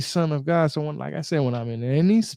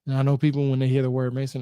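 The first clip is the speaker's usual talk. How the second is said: ordinary delivery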